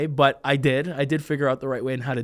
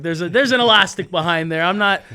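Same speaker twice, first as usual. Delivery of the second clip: put-on loud, higher, know-it-all kid; six-year-old busting the secret; smug callout; 4 semitones higher, 7 dB louder than usual. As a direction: call out the trick proudly. Child calling out the trick.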